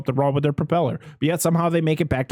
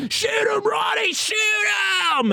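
very high-pitched mock shouting; panicked boat-driver impression; imitated frantic yell. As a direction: urge him on in a hammed-up high shout. Voicing boat driver urging a shot.

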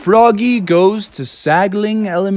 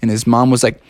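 ordinary delivery

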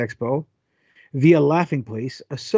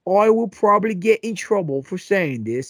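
put-on light Australian accent, higher; 4 semitones higher than usual. Co-host quoting the actress in a light Australian accent. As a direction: quote her in a mild Australian accent. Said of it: "Is Australia now?".

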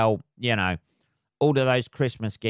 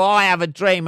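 Martin Luther King; 8 semitones higher, 6 dB louder than usual. Retold or imitated. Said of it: "he didn't go".

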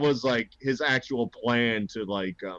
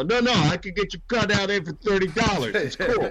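shady scalper; 5 semitones higher than usual. Retold or imitated. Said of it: "a scalper at the stadium who's like".

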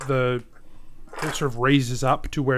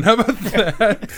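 ordinary delivery

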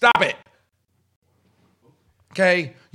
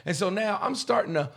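ordinary talk, nothing marked

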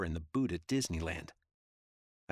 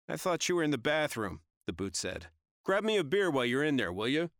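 calling out; 8 semitones higher, 4 dB louder than usual. Acted. Guy calling to other room.

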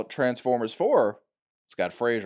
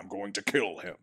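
Optimus Prime impression; 5 semitones lower, 9 dB quieter than usual. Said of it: "He's like".

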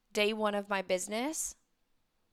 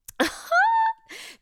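loud high laugh; 8 dB louder than usual. Real, not acted. Host bursting into a high laugh to end her talk.

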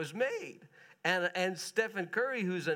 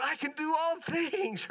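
higher, laughing mock triumph; laughing boast; 10 semitones higher than usual. Preacher mocking athletes who claim the verse, laughing boast. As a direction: crow it like a winning athlete.

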